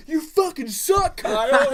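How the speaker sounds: high, loud, drawn-out taunt; gloating yell. Acted. Gloating at beaten little brother, loud taunting yell. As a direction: yell it slowly in his ear. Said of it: "I yell in his ear after".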